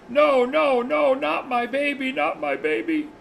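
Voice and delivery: high, loud, drawn-out mock wail, falling at the end; imitated woman's screaming. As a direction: imitate a mother crying out for her baby. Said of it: "he hears a woman screaming".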